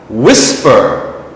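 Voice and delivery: "whisper" punched on WHIS, falling after; stress on first syllable. Shown as a demonstration. Teacher repeating for learners, front syllable stressed in "whisper". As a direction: model "whisper" clearly as WHIS-per. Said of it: "is in the front of the word".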